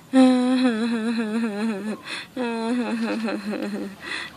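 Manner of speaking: long pretend sob, pulsing on; drawn-out play-crying without words; mock sobbing. Girl with nothing to shop with, long mock sobs. Girl cries, cannot go to market.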